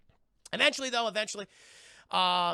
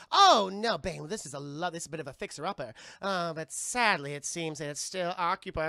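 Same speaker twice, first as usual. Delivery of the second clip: haughty lady voice; 5 dB louder than usual. Retold or imitated. Poison Ivy complains to Bane about the hideout, prissy. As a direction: sniff at the place like a snob. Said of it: "And they're like".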